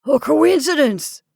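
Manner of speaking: loud, slow, flustered reply; flustered protest; stammered. A narrator's reading. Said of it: "stammered the old man".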